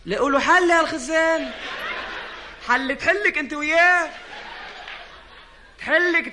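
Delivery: high, loud laughing argument; heated shouting. Acted. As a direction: shout back, half laughing. Heated quarrel.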